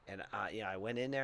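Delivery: normal talking voice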